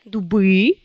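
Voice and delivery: "doubri" with a voiced B; "doubri" keeping the B voiced; voiced B before vowel. Shown as a demonstration. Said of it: "s'il y a le voyelle après".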